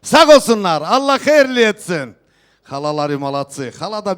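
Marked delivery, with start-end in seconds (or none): 0.0-2.1 loud festive proclamation to the hall; turkish wedding announcer. Real, not acted. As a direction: call out each gift to the guests. Host proclaims gifts at wedding.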